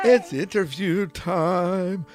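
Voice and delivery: slow, loud, drawn-out announcer call. Pretend. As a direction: boom it out like a show host.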